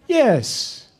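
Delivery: high, drawn-out imitated yes; Bernardo Bertolucci. Director insisting on the accent, high imitation. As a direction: confirm it in a high put-on voice.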